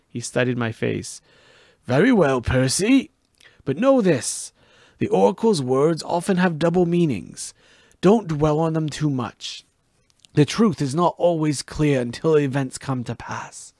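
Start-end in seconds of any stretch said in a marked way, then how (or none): 1.9-13.7 higher, louder mentor voice, steady and grave; wise mentor voice. Acted. Chiron advising Percy, grave mentor voice. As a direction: counsel the boy gravely.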